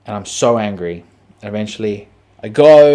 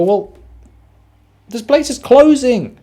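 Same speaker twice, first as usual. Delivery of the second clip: higher, louder whiny friend voice; mocking friends; 12 semitones higher, 8 dB louder than usual. Comedian imitates friends saying the cafe is closing, higher whiny voice. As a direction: mimic the friends being snippy about closing time. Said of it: "And they go".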